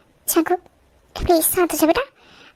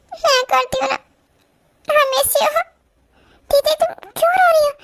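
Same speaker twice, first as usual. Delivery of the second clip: tearful; 8 semitones higher than usual. Acted. Family in tears before surgery.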